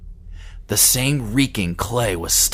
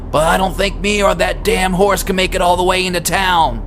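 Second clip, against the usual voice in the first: higher, louder anxious farmer's voice; Carl, worried farmer; 10 semitones higher, 5 dB louder than usual. Acted. Carl urging Eddie to drive into town, louder and higher. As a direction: push the worry up and out. Carl's worried pleading lines.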